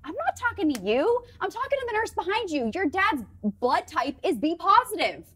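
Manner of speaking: high-pitched imitated correction; nurse's retort. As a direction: set them straight, acting out the nurse. Acting out the nurse's correcting reply.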